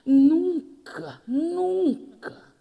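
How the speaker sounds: put-on high, drawn old woman voice; a sheepish old woman saying never; high mock old lady voice; old lady confession.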